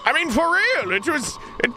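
high, panicked, near-crying rant; frantic escaped witness. Retold or imitated. Player imagines telling people about the asylum, high panicked rant. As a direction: babble it like a traumatized survivor begging belief. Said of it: "and be like".

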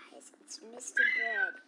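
hushed whisper to the guys. Acted. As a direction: lean in and whisper. Whispering to others.

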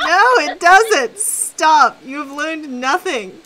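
loud, high, slow telling-off; exasperated scolding. Real, not acted. Scolding a friend for the accent joke, exasperated. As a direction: shut the joke down at high volume. Mock outrage at friend's bad pun.

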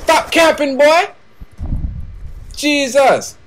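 high-pitched exasperated complaint; mad. Real, not acted. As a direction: scold a camper, rising into exasperation. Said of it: "Yeah, I am mad".

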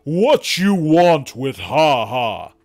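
a hint of a gruff giant; demanding giant. A narrator's reading. Giant glowers and demands at the door, slow and loud. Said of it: "glowers at us ... demands to know".

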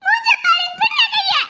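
high-pitched wail; tearful, high protest; crying. Acted. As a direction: cry out for mother. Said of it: "இப்ப எதுக்கு இப்படி அழுவுற".

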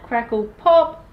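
plain reading voice

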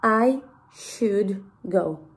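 "I... should... go", one word at a time; very slow, deliberate English with clear pauses; slow model sentence. Shown as a demonstration. Teacher showing how the sentence goes, slow model sentence. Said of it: "O sea, va así".

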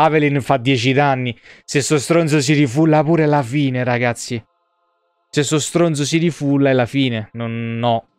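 ordinary talk

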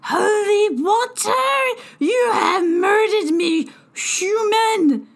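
high, loud keening shriek; poisoned fairy screaming at the human; horrified scream. A narrator's reading. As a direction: shriek in mortal terror. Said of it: "then screamed".

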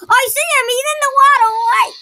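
high, loud excited call. Real, not acted. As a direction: shout it out, thrilled. Excited spotting in game.

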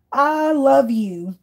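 slow adoring. Real, not acted. Reacting to clip with delight.

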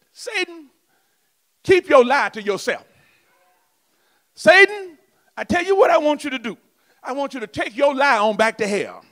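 a hint of a stern rebuke to Satan; telling off the devil. Preacher acting out rebuking Satan, mildly stern. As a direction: order Satan away, firm and sure. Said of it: "So, so tell the devil".

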